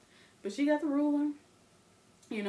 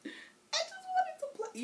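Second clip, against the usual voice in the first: put-on much higher, tearful little-girl wail; half-laughing fake sob; mock crying kid; slower than usual. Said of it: "I'm like".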